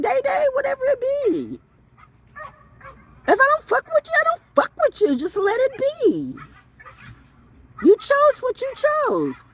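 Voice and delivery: high, dismissive singsong; high mocking voice. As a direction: brush the caller off with a high mock voice.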